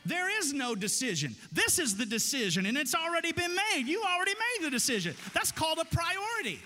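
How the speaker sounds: loud, high, driving the point home; building preacher shout. Real, not acted. Preacher urging married listeners to refuse flirting, shouting with conviction. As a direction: preach it at full force.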